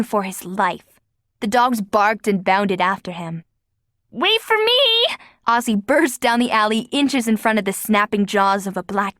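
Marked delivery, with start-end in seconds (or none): 4.1-5.1 small creature panicking; fleeing ferret. A narrator's reading. Ferret running from dogs.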